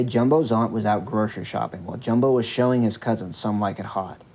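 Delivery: usual speaking voice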